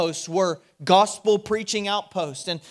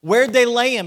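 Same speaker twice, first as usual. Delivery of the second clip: urgent question; 4 semitones higher, 7 dB louder than usual. Retold or imitated. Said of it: "he goes".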